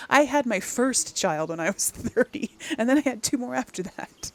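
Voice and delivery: amused indignation breaking into laughter; laughing protest. Real, not acted. Mother of three objecting to the letter, laughing protest. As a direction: protest through a real laugh, pitch lifted.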